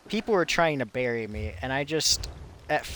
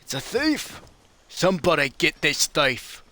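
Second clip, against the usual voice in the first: calling out; 2 semitones higher than usual. Acted. Calling out to game guards.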